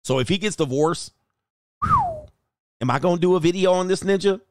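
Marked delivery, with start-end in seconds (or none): 1.8-2.3 long whistled whew. Real, not acted. Reacting to imagined divorce video.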